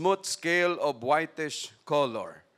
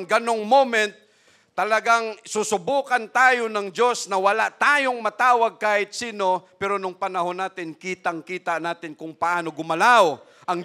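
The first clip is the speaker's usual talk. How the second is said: loud, impassioned sermon build; preacher swelling to a loud peak; rising preaching; 4 semitones higher, 7 dB louder than usual. Acted. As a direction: lift the church with conviction. Preaching rises again.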